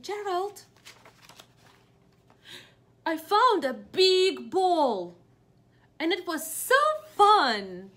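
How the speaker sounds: high, loud, slow piggy voice; excited piggy. Acted. Piggy telling Gerald about the ball, high and loud. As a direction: bounce it up high like a happy little pig. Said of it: "the piggy is saying".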